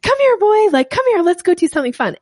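way up high, calling a dog with excitement; high-pitched dog-calling voice. Retold or imitated. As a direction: call it out like you're calling your puppy. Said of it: "with this, like, high-pitched energy".

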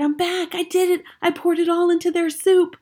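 put-on high, eager boy voice; excited. Boy mouse excited, higher.

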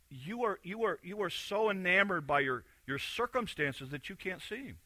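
usual speaking voice

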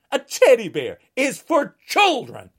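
ordinary talk

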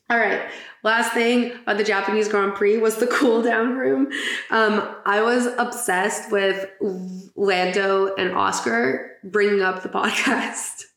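ordinary talk

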